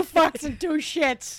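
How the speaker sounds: slow, high, loud mock outrage; hammed-up yelling; mock scolding shout. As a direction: holler it like a fed-up scolder. Mock scolding over video game swearing.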